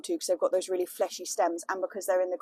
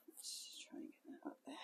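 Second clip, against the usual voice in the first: whispering; 29 dB quieter than usual. Real, not acted. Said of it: "concentrating on delicate task".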